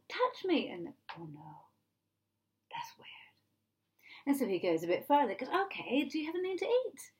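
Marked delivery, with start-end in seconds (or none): 0.1-0.7 high-pitched coaxing; acted invitation. Jesus invites doubters to touch, high coaxing. 1.2-3.1 very soft, low acted aside; hushed reluctance. Believers balk at touching Jesus, hushed reluctant murmur. 5.5-7.1 quick, high-pitched question; acted casual request. Jesus goes further with doubters, quick high request.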